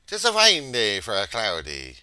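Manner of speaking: loud, hammed-up stage Irish greeting; Irish accent. Vlogger greeting viewers on Saint Patrick's Day, in a hammed-up Irish accent. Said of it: "I don't know how to do this accent".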